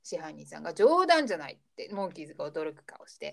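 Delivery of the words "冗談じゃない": higher, louder acted protest; indignant refusal. Manager refusing the hired band's request for food, mock indignation. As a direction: imitate an outraged boss refusing.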